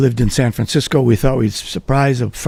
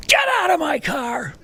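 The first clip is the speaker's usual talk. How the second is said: driver shouting; 5 dB quieter than usual. Retold or imitated. Driver throws them out.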